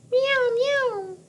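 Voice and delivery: very high mewing meow; catbird meow. A narrator's reading. Teacher reading to class, mewing like a catbird. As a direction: mew like a hungry cat. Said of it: "Catbird sounds like a hungry cat".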